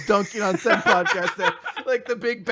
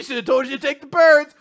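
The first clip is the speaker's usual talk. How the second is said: mock shout; 5 semitones higher, 8 dB louder than usual. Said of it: "he says that at the end".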